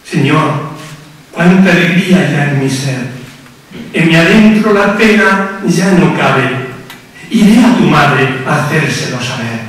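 ordinary talk

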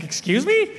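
high incredulous excuse me; surprised. Retold or imitated. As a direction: sound taken aback. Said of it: "I was like".

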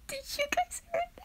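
can't believe what you just heard, fast and high; thrilled disbelief. Real, not acted. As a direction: fan hearing a reply from her idol, squealing. Reacts to hearing his reply.

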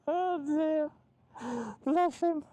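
helpless, high-pitched belly laughter through the words; real chuckling fit. Real, not acted. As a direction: crack up and struggle to finish the sentence. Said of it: "it does make me chuckle".

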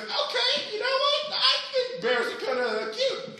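high-pitched wild man voice. Acted. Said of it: "The wild man's like".

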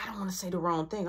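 fast nervous whisper; scared friend's hushed whisper. Retold or imitated. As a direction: hiss it so mom can't hear. Whispering friends hiding from mom.

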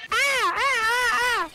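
slow alarmed ah cries; panicked cries. Real, not acted. Kid panics in a Fortnite fight, slow frightened cries. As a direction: yelp in fright as the enemy appears.

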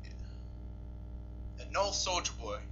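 usual speaking voice

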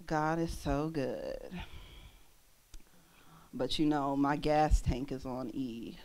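low, slow, dejected mumble; unappealing testimony. Retold or imitated. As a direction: sag through it, sinking to a trail-off. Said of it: "an unappealing God".

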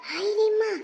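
high, loud hai re maa; shocked dismay. Acted. Woman gossiping, shocked by daughter-in-law's news. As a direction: cry out in dismay.

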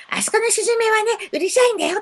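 squeaky high-pitched gossip; gossiping bird voice. Pretend. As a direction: play a tiny bird badmouthing the neighbours. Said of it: "とか言ってね".

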